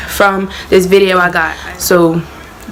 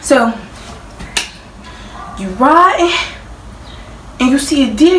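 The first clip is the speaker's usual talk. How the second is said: normal talking voice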